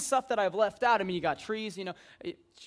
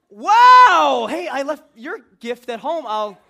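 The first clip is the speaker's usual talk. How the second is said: much higher and louder, a mock gushing "wow" with a laugh; fake excited thanks; 5 semitones higher, 17 dB louder, slower than usual. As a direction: overplay the delighted guest, loud and high. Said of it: "And you're like".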